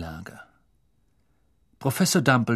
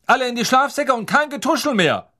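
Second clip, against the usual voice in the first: much higher and louder, stressing kein; bossy shout; 12 semitones higher, 5 dB louder than usual. Acted. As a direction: enforce silence with authority. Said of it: "rief Percy".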